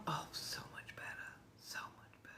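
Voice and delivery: breathy relieved whisper. Real, not acted. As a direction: whisper it, fast and airy. Relief after tooth pain.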